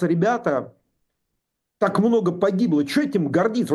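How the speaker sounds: ordinary talk, nothing marked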